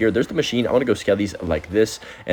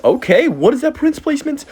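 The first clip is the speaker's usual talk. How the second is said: baffled; 4 dB louder than usual. Real, not acted. Opponent makes a surprising Prince placement.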